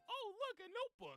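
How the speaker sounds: high sing-song mock surprise; mock dad voice. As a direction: chirp it like a cheerful dad. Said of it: "Mister Yagami inspects the car and is like".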